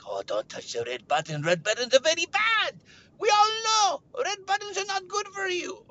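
mock pleading that rises into a whine; pleading friend. Josh warns about the red button, rising wail.

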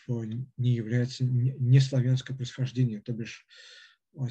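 ordinary talk

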